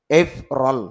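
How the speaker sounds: এফ রল with stress on the opening এফ; এফ রল, stressed "eip" then "rol"; sounds joined together. Shown as a demonstration. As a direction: say April as এফ রল, ending in "rol". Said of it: "তাহলে একসাথে হবে".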